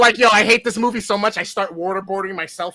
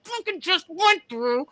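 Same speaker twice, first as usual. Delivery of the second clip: higher, fast-building angry rant, hammed up; retold furious rant; 10 semitones higher than usual. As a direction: replay the meltdown, voice pitched up. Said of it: "I was irate".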